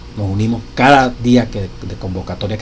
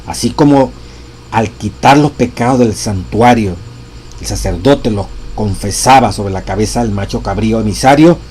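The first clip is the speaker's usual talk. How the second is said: louder, weighty scripture reading; preacher proclaiming the text; solemn reading aloud; 6 dB louder than usual. Acted. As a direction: read each phrase with gravity. Continues reading the quote.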